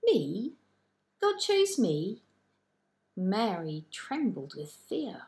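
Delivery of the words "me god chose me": put-on high, astonished girl's voice rising in disbelief; amazed disbelief. A narrator's reading. Mary stunned that God chose her, high astonished disbelief. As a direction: gasp it out as if you can't believe it. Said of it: "Mary couldn't believe her ears".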